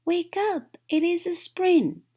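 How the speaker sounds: high, loud and eager; excited shout. A narrator's reading. Frog calling Toad awake on a spring morning, excited shout. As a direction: yell the good news through the door.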